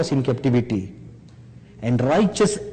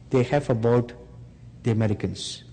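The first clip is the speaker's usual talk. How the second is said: usual speaking voice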